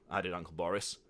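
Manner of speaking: usual speaking voice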